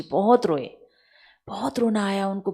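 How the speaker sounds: ordinary talk, nothing marked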